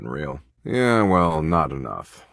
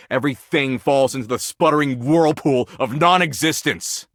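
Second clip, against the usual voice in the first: despairing rant; 8 semitones higher than usual. Acted. Despairing rant about everything dying.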